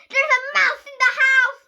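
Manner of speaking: high, loud shrieking alarm; panicked scream. Acted. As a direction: shriek the news from on top of the table. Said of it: "she screamed".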